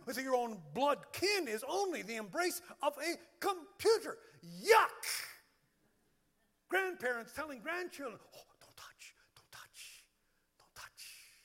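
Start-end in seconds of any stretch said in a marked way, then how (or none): 4.6-5.3 loud, high-pitched cry of revulsion; mock disgust. Pastor reacting to germ fears, loud mock disgust. Acted disgust at germs and computer embrace. 8.4-11.4 hushed, anxious-grandparent whisper; whispered warning. Acted. Grandparents telling grandchildren, whispered warning.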